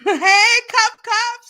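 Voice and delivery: high, loud delighted hello; light up at a friend; excited greeting. Real, not acted.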